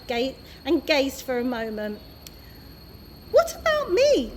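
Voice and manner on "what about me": high, louder demand. A narrator's reading. Mister Stink demanding to be included, indignant. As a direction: insist on being included. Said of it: "demanded Mister Stink".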